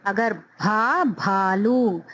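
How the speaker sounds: भा and भालू drawn out, breathy भ; slow aspirated भालू; slow model reading. Shown as a demonstration. Teacher showing aspirated भ in भालू. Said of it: "दोनों की आवाज साथ आनी है".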